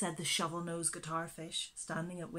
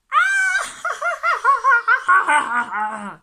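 a big shrieking burst of laughter; loud high laugh; 18 dB louder than usual. A narrator's reading. The animals laughing at the blobfish, a loud high laugh. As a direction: laugh out loud at the silly name. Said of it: "laughed all the other animals".

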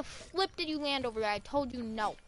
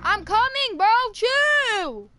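much louder and higher, annoyed yell; exasperated shout; 10 semitones higher, 10 dB louder, much slower than usual. Real, not acted. Teammate yells at impatient friend, exasperated and loud. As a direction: bark it back across the map. Repeated urgent revive shout.

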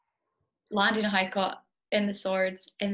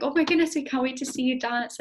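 higher, louder quote of thrilled dancers; excited fans; 4 semitones higher, 6 dB louder than usual. Retold or imitated. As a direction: imitate fans gushing with excitement. Retelling excited fans' greeting.

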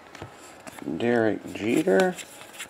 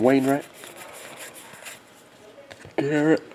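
ordinary talk

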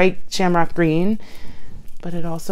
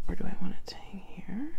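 whispering; 6 dB quieter than usual. Real, not acted. Whispered musing.

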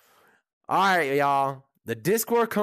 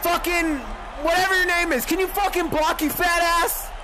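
high, angry yell at the screen; furious shout; 7 semitones higher than usual. Real, not acted. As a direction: tear into the blocker. Raging at a lineman in game.

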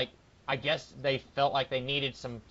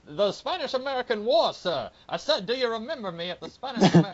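put-on Foghorn Leghorn accent; 8 semitones higher, 6 dB louder than usual. Retelling the doctor's surrender scene in a hammed-up Southern accent. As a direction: ham up a cartoon rooster's Southern accent. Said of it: "Foghorn Leghorn accent again".

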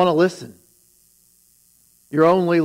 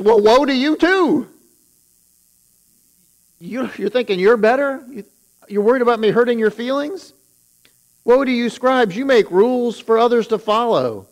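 higher, louder fiery retort; Jesus rebuking; 8 semitones higher, 3 dB louder than usual. Retold or imitated. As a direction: fire it back at the complaining scribe. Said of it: "he says".

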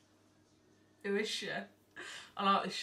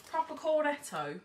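usual speaking voice

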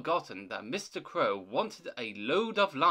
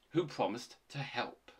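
usual speaking voice

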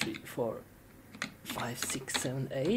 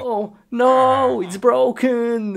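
much higher, startled oh no; dismayed surprise; 10 semitones higher than usual. Real, not acted. Gamer finds his computer broken, high dismayed yelp. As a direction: yelp it as it breaks.

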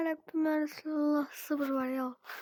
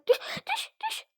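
playful urging. Acted. Toy game, urging doll to fall.

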